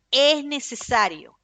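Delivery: slow, loud emphatic proclamation; loud preaching. Real, not acted. Preacher insisting to the congregation, loud and slow. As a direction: drive the point home at full volume. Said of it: "Perdónenme el tono de voz".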